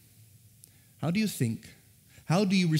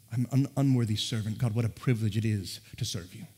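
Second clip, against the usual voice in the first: humble quiet prayer; 6 semitones lower, 5 dB quieter than usual. Pretend. Modeling a humble servant's prayer.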